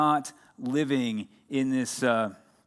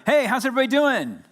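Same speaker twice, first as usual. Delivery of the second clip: much higher, loud, with a laugh; loud excited greeting; 11 semitones higher, 7 dB louder than usual. Shown as a demonstration. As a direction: belt out "Hey, how's everybody doing?" like a hyped host. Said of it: "You wanna try it one more time?".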